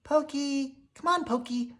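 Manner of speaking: high puppy voice calling out; calling sister. A narrator's reading. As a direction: call him from a distance. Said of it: "heard his sister Pickles call".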